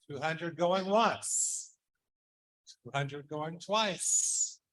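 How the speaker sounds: slow, drawn-out going once, going twice; slow drawn-out call. Acted. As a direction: stretch out the final call. Closing the bid slowly.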